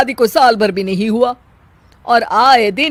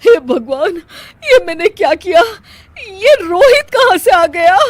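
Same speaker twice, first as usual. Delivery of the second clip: horrified dismay; 8 semitones higher, 4 dB louder than usual. Acted. Meena realises she hit Rohit.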